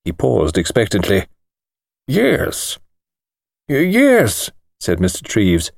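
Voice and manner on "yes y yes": put-on an old man's thin, higher, precise voice; lightly voiced elderly lawyer, startled. A narrator's reading. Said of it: "thin, precise little voice".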